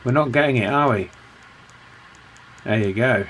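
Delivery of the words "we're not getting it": ordinary delivery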